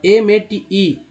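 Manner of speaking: slow, deliberate letter-by-letter spelling; name each letter slowly; spelled out letter by letter. Shown as a demonstration. Teacher spelling a word for students, slowly letter by letter. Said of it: "मेट".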